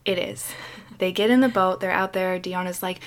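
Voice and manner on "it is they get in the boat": normal talking voice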